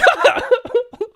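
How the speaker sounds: amused high laugh at himself. Real, not acted. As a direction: burst of high-pitched laughter. Laughs at own joke.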